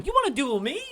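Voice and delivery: put-on high cocky taunting kid; mock trash talk. Challenging a viewer to duel, mock swagger.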